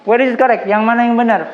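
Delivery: ordinary talk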